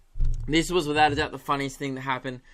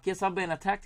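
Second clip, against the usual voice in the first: higher-pitched imitation of a Kiwi caller; New Zealand accent; 4 semitones higher, 4 dB quieter than usual. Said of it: "I've got it now".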